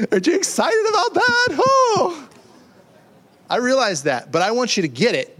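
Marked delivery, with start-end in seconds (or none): 0.1-2.3 much higher, laughing, sarcastic cheer; mock excitement. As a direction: tease them with fake excitement. Mock enthusiasm at the congregation.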